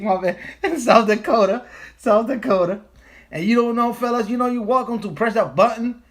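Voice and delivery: high, loud laughing holler; excited hollering. Real, not acted. Streamer excitedly welcoming a viewer from South Dakota, loud and high. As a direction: lean in and holler it with a grin.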